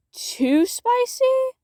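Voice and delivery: slow, high, teasing stretch; teasing drawl. Real, not acted. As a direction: draw out the words playfully.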